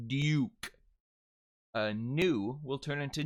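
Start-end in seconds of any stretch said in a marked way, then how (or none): 0.1-0.7 DUKE said 'dyook' with a clear glide; fluid J. Shown as a demonstration. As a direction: put a Y glide after the D in DUKE. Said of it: "use the fluid J".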